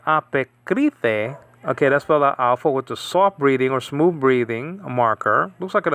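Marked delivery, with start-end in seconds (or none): 0.1-1.4 "apekrite" read slowly, syllable by syllable, rising at the end; slow Greek reading. Shown as a demonstration. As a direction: spell out "apekrite" slowly for the students. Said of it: "it says over here".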